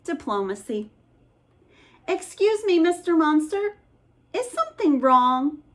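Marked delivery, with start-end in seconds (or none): 2.1-5.6 high, timid polite asking; polite kid. Acted. Boys politely asking the monster what is wrong. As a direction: ask the monster nicely.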